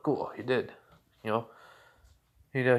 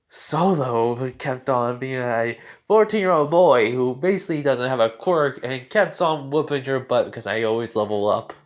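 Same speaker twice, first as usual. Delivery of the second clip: gloating taunt; 6 dB louder than usual. Retold or imitated. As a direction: crow loudly over beating a rival. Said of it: "he just rubs that in".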